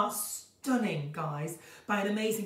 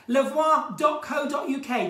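loud, slowed call of the web address; shouted plug; 5 semitones higher, 6 dB louder, slower than usual. Real, not acted. Host announces the calendar website to viewers, loud and slow.

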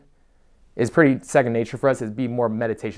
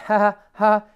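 high, loud fake laugh; mock an anxious people-pleaser's laugh; forced laugh; 8 semitones higher, 5 dB louder, slower than usual. Imitates someone afraid to offend anybody.

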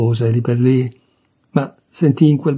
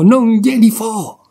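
much higher, slow, drawn-out refusal; drawn-out defiance; 9 semitones higher, 3 dB louder, slower than usual. Acted. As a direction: stretch each word in stubborn spite. Nenè's refusal climbing to peak.

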